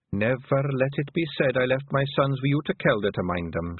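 ordinary delivery, nothing marked

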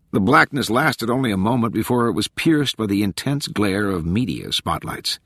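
ordinary delivery, nothing marked

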